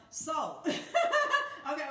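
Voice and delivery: bursts out laughing, loud and high. Real, not acted. Laughing at her own slip mid-talk, loud laughing. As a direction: laugh it off at yourself.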